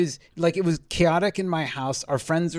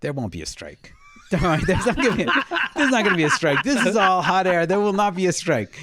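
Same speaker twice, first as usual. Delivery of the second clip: mock reassurance; 4 semitones higher, 5 dB louder than usual. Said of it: "I was like".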